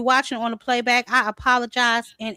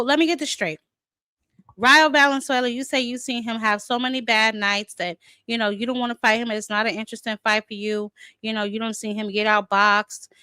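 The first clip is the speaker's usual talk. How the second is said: usual speaking voice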